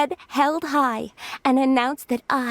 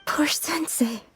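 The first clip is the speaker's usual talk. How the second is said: pitying whisper; 3 semitones higher, 3 dB quieter than usual. Acted. Pitying the suffering master.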